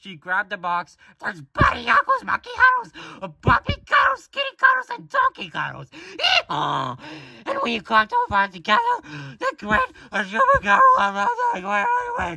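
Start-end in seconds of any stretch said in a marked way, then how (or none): 1.2-12.4 excited high falsetto rattle; Ami. Acted. Ami gushing over the toy set, squeaky girl voice. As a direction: squeal through the list, loud and giddy. Acting Ami listing the toys.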